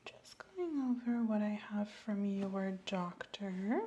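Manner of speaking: slow unvoiced whisper while reading notes; doctor whispering over the patient's notes. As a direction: whisper slowly as if reading the chart.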